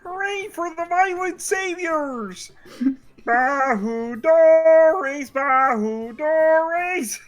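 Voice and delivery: high, slow laughing cheer; mock cheer. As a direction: cheer like a grateful crowd.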